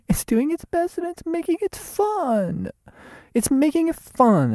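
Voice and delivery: very high, silly squeaky delivery; goofy high voice. Acted. Goofy high bit after laugh.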